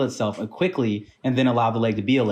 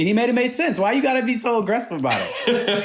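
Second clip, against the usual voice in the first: high-pitched, playful indignation; mock indignant protest; 12 semitones higher than usual. Real, not acted. Podcast host defending his question to teasing co-hosts, mock indignant.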